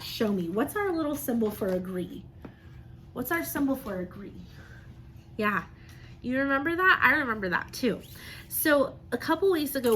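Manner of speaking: usual speaking voice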